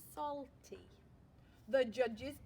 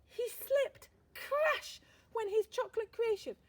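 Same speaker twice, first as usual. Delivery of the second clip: much higher and louder exclamation; higher, louder storyteller alarm; dramatic alarm; 10 semitones higher, 7 dB louder than usual. A narrator's reading. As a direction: cry it out as disaster strikes.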